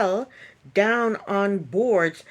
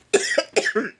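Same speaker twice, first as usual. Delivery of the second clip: harsh real coughing fit; 3 semitones higher, 4 dB louder than usual. Real, not acted. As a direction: cough hard several times. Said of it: "Excuse me, I just had a coughing fit".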